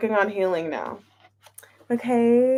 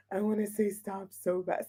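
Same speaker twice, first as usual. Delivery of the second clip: much softer, low aside; quiet; 4 semitones lower, 11 dB quieter than usual. Real, not acted. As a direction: drop to a quiet mutter.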